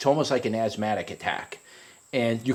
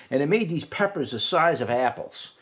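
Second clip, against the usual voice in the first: ordinary talk, nothing marked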